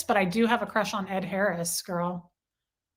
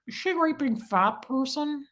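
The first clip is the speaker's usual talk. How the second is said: Buffalo Bill; 4 semitones higher than usual. Retold or imitated. Showing viewers her Ted Levine, weird villain impression. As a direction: put on an odd movie killer's voice, pitched up. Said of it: "I could do Ted Levine".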